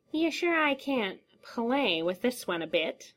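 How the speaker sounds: eager clown. A narrator's reading. The clown asks the creature to keep toying with Eames, wheedling. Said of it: "the creature snarled".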